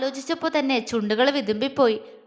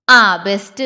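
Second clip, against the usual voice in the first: sarcastic; 4 semitones lower, 10 dB louder than usual. Acted. Friend mocking Jwala's naivety.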